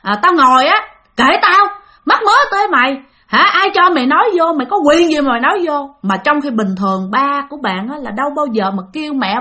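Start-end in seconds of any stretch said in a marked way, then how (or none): 0.0-5.9 put-on angry drunk snapping. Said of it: "ba mới hên chửi nha". (giọng chửi say xỉn, to và cao)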